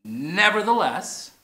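deliberate "Nevertheless" together; slow unison. Shown as a demonstration. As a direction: lead the word slowly. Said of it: "let's say the word together".

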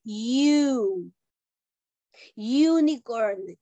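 "U" as a long yoo, then "Unicorn" slowly as YOO-nee-korn; consonant-like YOO. Shown as a demonstration. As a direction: sound out the y at the start of "U" and "Unicorn". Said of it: "¿Cómo suena el unicorn?".

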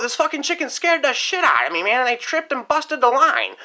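ordinary delivery

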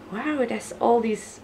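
ordinary talk

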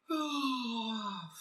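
anguished cry. Real, not acted. Cry-shout at rant peak.

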